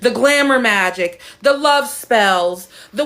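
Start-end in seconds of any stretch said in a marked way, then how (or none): none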